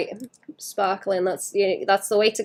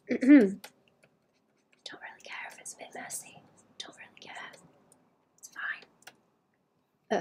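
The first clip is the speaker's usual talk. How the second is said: whispering; 4 semitones higher, 6 dB quieter than usual. Real, not acted. Whispering to herself while painting.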